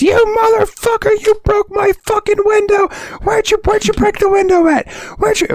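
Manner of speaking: very high, screechy mock outrage; imitated screaming. Neighbor screaming over the broken window, imitated screaming. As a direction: screech it far up high, hammed-up fury. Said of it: "we start hearing this".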